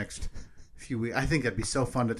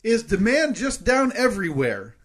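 slow, higher emphatic host read; game show host; 9 semitones higher, 5 dB louder than usual. Acted. Reads poll questions.

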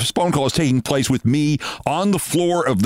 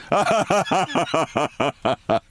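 short laugh; 4 semitones higher than usual. Real, not acted. Laughs at his own remark.

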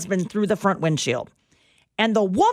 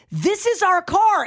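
insistent claim; 10 semitones higher than usual. Retold or imitated. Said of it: "And she goes".

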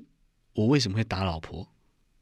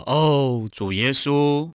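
loud, high, drawn-out cry to the Lord; loud calling out; 5 semitones higher, 5 dB louder, much slower than usual. Retold or imitated. Imitating a friend on the mountain calling on the Lord, loudly. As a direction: imitate a fervent public shout. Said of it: "就很大声的呼求了主名".

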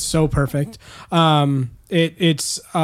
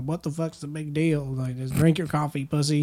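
put-on southern accent; 7 dB quieter than usual. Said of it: "I gave him a southern accent".

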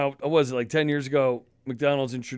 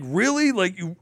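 high mock disbelief, louder; pitched-up who-are-you-kidding really; incredulous; 7 semitones higher, 4 dB louder than usual. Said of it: "I'm like".

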